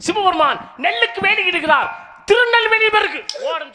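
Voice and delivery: loud, high-pitched ringing proclamation, last words held long; stage declamation. Acted. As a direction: proclaim the temple legend to the whole crowd. Stage declamation of temple legend. (உரத்த மேடைப் பிரசங்கம்)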